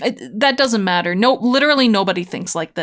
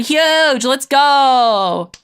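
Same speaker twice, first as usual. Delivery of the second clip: excited cheer; 5 semitones higher, 6 dB louder, much slower than usual. Real, not acted. Game promotion celebration.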